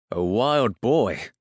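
astonished outburst; astonished exclamation. A narrator's reading. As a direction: exclaim it in amazement, stretching the words. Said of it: "Thomas exclaimed".

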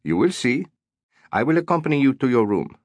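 polite manager choosing each word; careful English. A narrator's reading. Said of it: "He spoke in careful English".

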